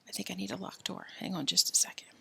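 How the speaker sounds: soft unvoiced whisper; whisper aside. Real, not acted. Narrator setting up a scene, whispering. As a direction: murmur to yourself while setting up.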